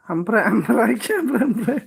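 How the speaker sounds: real laughter breaking into the words; drawn-out words dissolving into giggles; laughing. Real, not acted. Streamer repeating a silly phrase to viewers, laughing.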